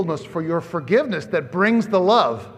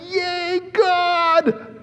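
a much higher, louder joyful yelp; mock cheer; 12 semitones higher, 5 dB louder than usual. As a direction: cheer like a delighted guest. Guests should cheer joyfully.

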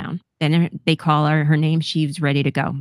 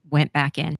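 usual speaking voice

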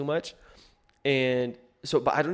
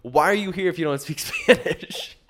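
laughing quote; 7 semitones higher, 8 dB louder than usual. Retold or imitated. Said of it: "She's like".

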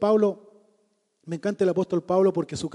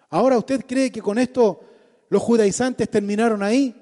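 usual speaking voice

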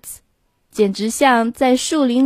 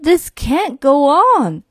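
exasperated father; 6 semitones higher, slower than usual. Acted. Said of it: "his father said".

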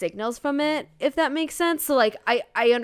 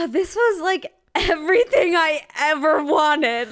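high, emotional gush on a sigh; breathless, teary delight; swooning; 6 semitones higher, 4 dB louder than usual. Real, not acted. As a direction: swoon like it's a dream come true. Swooning over favourite scene.

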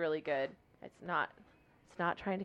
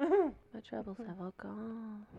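dramatic mock line; 4 semitones higher, 4 dB quieter, much slower than usual.

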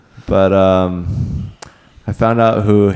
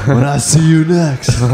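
wrestling promo; 5 semitones higher than usual. Pretend. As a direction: call him out like a wrestler. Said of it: "cut a wrestling promo for Darby Flowers".

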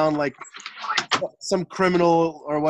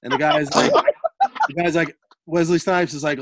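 normal talking voice

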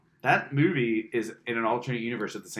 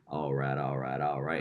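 much lower, lazy all right, all right, all right; Wooderson imitation; 11 semitones lower, 6 dB quieter than usual. Imitating the guy answering the bartender, low and lazy. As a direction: drop the voice low and loll through the catchphrase like a stoned charmer. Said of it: "And he's like".

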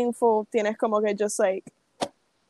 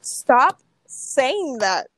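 slow protest; 4 dB louder, much slower than usual. Real, not acted. Protesting friend's teasing.

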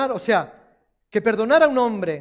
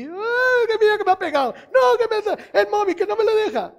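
niña quejica; 11 semitones higher than usual. Retold or imitated. Niña quejándose a la madre.

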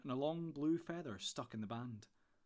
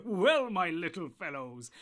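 loud, slow, still laughing; 5 semitones higher, 10 dB louder, slower than usual. Acted. Tom laughing as he greets the hobbits. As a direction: speak through the laugh.

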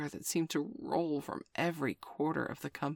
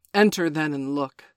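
louder, stiff and grudging; 10 dB louder than usual. Acted. Wolf grudgingly admits the jackal, stiff. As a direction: let him in coldly. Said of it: "said Father Wolf stiffly".

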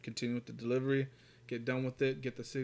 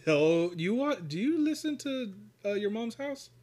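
suddenly thrilled to ask; bursting to ask his cohost about a favorite podcast, excited; excited question; 9 semitones higher, 4 dB louder than usual. Real, not acted. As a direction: pitch jumps way up, eager. Sudden excited topic switch question.

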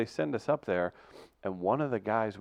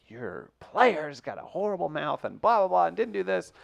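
complaining teacher; 8 semitones higher, 4 dB louder than usual. Retold or imitated. Teacher telling coach about his player, complaining. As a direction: rant it as an exasperated teacher.

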